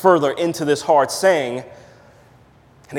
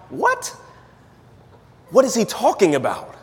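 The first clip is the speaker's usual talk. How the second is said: baffled disbelief; 6 semitones higher than usual. Retold or imitated. Preacher voices baffled listener.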